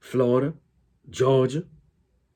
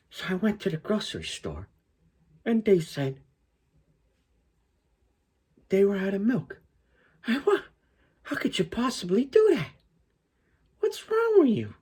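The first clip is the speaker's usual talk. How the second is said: much higher, exasperated Italian-American comic voice; Sebastian Maniscalco impression; 11 semitones higher than usual. Vlogger imitating the comedian ranting about no milk, high and exasperated. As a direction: rant in an outraged high Chicago-Italian voice. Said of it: "he's pronouncing his R's".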